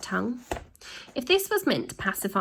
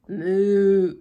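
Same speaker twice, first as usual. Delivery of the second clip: louder cow moo; 6 dB louder than usual. Acted. Peeved cow moos at Ned, louder.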